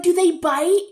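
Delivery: put-on high, loud worried kid voice; a nervous child asking high and loud about the snakes; kid's question. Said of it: "they're like".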